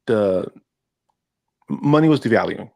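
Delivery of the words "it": ordinary talk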